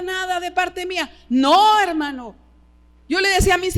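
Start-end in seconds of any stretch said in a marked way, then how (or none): 1.3-2.4 a sudden loud no. Real, not acted. Pastor stopping the congregation with a loud no. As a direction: cut them off with a loud no. Sharp preacher's rebuke.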